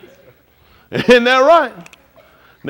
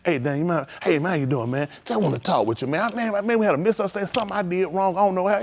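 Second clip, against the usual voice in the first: softer, easygoing, respectful; calm friendly approach; 6 dB quieter than usual. Acted. Approaching Dan to clear a misunderstanding, softly and respectfully. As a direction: come to him gently to patch things up. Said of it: "How about if I come to Dan?".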